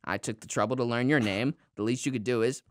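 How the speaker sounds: bitter seething correction, low and quiet; imitating Frank Grimes. Retelling Frank's rebuke of Homer, low seething impression. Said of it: "Frank goes".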